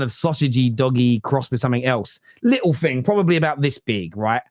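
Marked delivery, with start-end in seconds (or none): none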